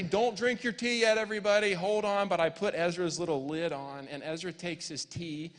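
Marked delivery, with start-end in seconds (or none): 0.0-2.3 raised voice, higher and louder; acted-out dad calling a halt; urgent parental warning. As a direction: call out to stop the kids. Father warning children in the story.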